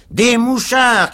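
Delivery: loud, high, drawn-out yell; crowd's shout. A narrator's reading. Crowd accuses the whole party, shouting. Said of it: "cria de nouveau la foule".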